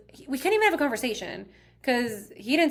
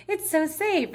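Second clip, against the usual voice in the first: gushing, high-pitched; 9 semitones higher, slower than usual. Retold or imitated. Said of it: "I'm like".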